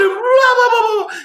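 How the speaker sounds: high, loud blah blah; mock rant. High loud gibberish mocking the daughter's rant. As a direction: keep the yelling teen going as gibberish.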